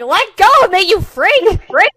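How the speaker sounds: high, very loud outraged shout; shrieked demand to be released; yelping protest. Acted. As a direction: yell it as you're being yanked down. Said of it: "she yelps and she goes down".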